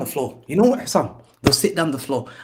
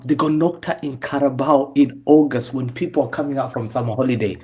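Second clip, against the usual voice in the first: ordinary talk, nothing marked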